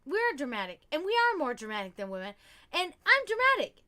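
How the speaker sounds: teasing confession, swelling to high and loud; hammed-up admission with a big finish; mocking agreement. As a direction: imitate a man theatrically conceding. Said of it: "he's mocking me".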